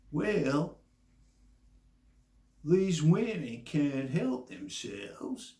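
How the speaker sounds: slow, put-on Southern accent; Doctor Phil. TV doctor excusing these women, slow Southern accent. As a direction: stretch it out in a mock Southern accent. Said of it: "let me do my Southern accent again".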